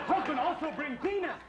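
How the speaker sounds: high, shouting pregnant wife; moody pregnant wife. Acted. Hormonal wife demanding ice cream from husband, high yelling. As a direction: swing from sweet to yelling, high.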